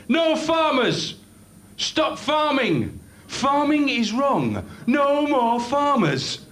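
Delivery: high, slow, drawn-out protest chant; street rant. Retold or imitated. Comic bloke chanting at farmers down the street. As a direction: bellow it like a one-man picket.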